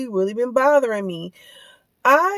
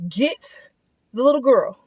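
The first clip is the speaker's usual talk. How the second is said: ordinary talk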